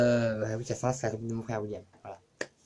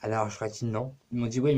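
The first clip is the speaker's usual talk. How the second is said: ordinary delivery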